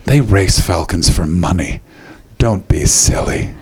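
low, slow, patronising; condescending tour manager. Retold or imitated. Tour manager brushing off John's worry, low and slow. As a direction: talk down to him like he's a child. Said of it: "she went".

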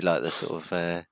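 normal talking voice

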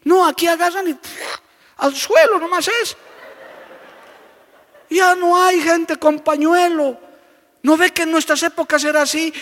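put-on high, loud elderly woman asking; old lady. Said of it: "una señora mayor". (señora mayor curiosa, voz aguda)

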